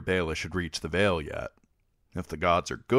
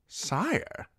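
a startled higher gasp of a word; shocked; 13 semitones higher than usual. Acted. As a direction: caught off guard by the king's cruelty. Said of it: "Sir Kevan said in a shocked voice".

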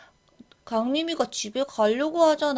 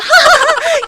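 high mocking cackle; 14 semitones higher, 13 dB louder than usual. Mocking teacher.

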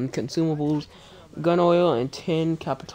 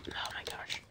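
whispered exasperation; 18 dB quieter than usual. Real, not acted. Frustrated at game character.

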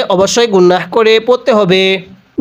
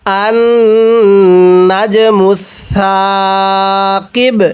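slow read-along. Shown as a demonstration. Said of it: "আরেকবার পড়ুন".

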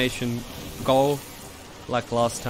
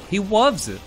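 cooing; 9 semitones higher, 6 dB louder than usual. Real, not acted. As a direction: coo at the little pet. Cooing at pet monkey.

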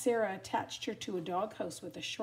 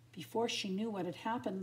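usual speaking voice

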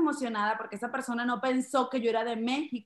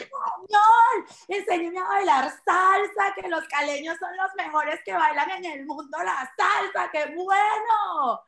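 loud, high, overjoyed exclaiming; hammed-up thrill at meeting a compatriot; gushing excitement; 6 semitones higher, 5 dB louder than usual. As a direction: gush with delight, stretching the last words. Gushing at supposed fellow Colombian.

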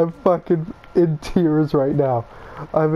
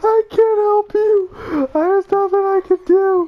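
frightened helplessness; 3 dB louder than usual. Real, not acted. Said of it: "I'm crying".

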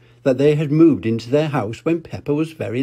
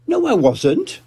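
high indignant little-pig voice; Peppa denying; 12 semitones higher than usual. Acted. Peppa arguing with Susie, high and indignant. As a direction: protest as a cross little girl. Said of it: "said Peppa".

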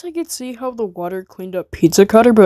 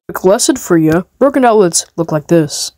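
usual speaking voice

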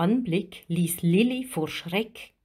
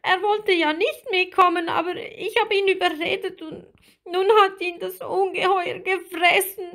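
very high, tearful confession; guilty little one crying, high-pitched; sobbing; 12 semitones higher, 4 dB louder than usual. A narrator's reading. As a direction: wail it in a small mermaid voice. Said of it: "schluchzte Lilli".

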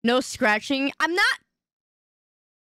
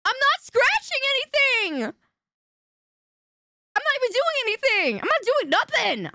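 high, pleading protest; whiny denial; 12 semitones higher than usual. Real, not acted. Streamer whines to chat he isn't scratching. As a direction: insist innocence in a high whine.

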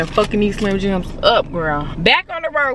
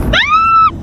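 excited shriek; 5 dB louder than usual. Real, not acted. Excited entering Texas.

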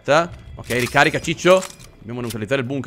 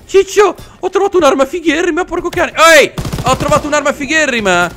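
much higher, thrilled "Ciccio, ho trovato un'arma figherrima"; excited boast; 9 semitones higher, 3 dB louder than usual. Real, not acted. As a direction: show off the cool gun. Excited boast about a new gun. (entusiasta, con la voce acuta)

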